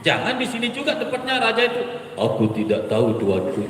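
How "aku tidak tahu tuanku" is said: a hint of a timid soldier, lightly voiced; frightened soldier. Shown as a demonstration. Soldier answers King Namrud, a touch fearful. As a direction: answer the master meekly. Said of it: "prajurit itu pun menjawab".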